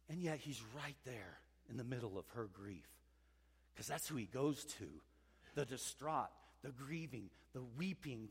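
gently, as to someone grieving; tender hush. Acted. Preacher speaking of the grieving, soft and tender.

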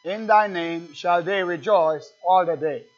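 scripture reading. Acted. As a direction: read the psalm out to the church with weight. Reads psalm aloud to church.

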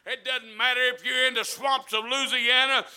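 loud, driving, slowed-out preacher's cadence; full-force preaching. Real, not acted. Preacher urging the church against discouragement, full-force shouted preaching. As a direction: preach it at a shout, building to the end.

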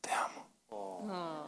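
slow whispered Te amo; intimate whisper. Shown as a demonstration. Husband whispering love to his wife up close, slow whisper. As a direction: breathe it softly into her ear. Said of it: "if I come close to her and said".

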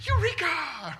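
high, loud cry of discovery; joyful eureka. Retold or imitated. Preacher acting out John's delight, exclaiming eureka. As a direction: shout it like you just found gold. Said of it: "is literally the word eureka".

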